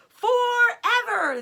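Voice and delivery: high, loud, slow joyful cry; group's ecstatic cry; cried joyfully. A narrator's reading. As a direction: cheer it out together. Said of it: "they cried".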